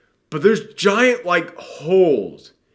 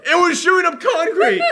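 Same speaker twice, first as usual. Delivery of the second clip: very high, laughing squeal; laughing outburst; 3 dB louder than usual. Real, not acted. Podcaster capping the grappling gun joke, laughing. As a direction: crack up while capping the joke.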